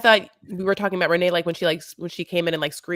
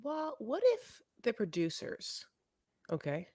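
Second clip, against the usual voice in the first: conspiratorial; 9 dB quieter than usual. Real, not acted. Conspiratorial lowered voice.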